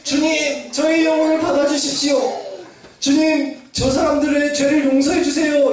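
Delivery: dying prayer. Acted. Dying Stephen praying for his killers, acted high plea. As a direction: plead earnestly as the dying martyr. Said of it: "피 흘려 죽어가면서도 스테반은".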